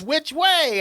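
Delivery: high, hurried question; mock urgency. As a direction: imitate a frantic dad needing directions. Frantic dad rushing, retold.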